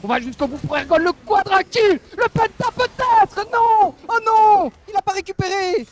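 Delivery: esports caster shouting a near pentakill; caster screaming. Real, not acted. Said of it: "Tu cries très fort".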